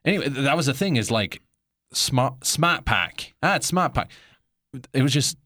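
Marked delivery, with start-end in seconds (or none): 1.9-3.3 put-on New England accent. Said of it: "New England accents". 3.4-4.1 put-on New England accent. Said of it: "New England accents".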